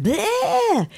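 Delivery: high-pitched exploding "bleh"; blurted bleh outburst. Retold or imitated. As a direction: let out a mock blow-up noise. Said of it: "I'm just like".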